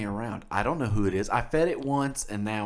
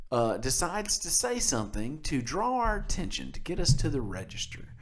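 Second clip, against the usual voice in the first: usual speaking voice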